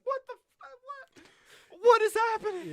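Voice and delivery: very high, slow stunned disbelief; very high, drawn-out mock bafflement; bewildered squeal. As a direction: squeak out total confusion. Kids bewildered as he leaves.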